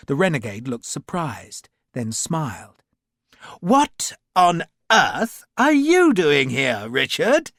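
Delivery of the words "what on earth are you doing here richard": higher, louder, slow, airy gentleman; breezy aristocrat amused at the surprise; oddly cheerful. A narrator's reading. Renegade Lavisser greeting Sharp, oddly cheerful. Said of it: "He sounded oddly cheerful".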